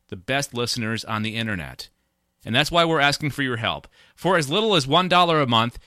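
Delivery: upbeat promo read voice. Acted. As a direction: pitch it like a sponsor plug. Sponsor plea read at the top.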